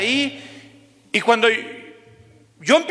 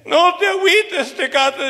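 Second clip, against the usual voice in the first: imitated tearful guard; 7 semitones higher than usual. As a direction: console a friend as the weeping guard, pitched up. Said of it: "él empieza a llorar también". (guardia llorando, imitado con voz aguda)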